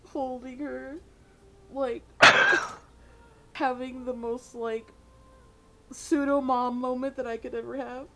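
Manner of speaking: ordinary delivery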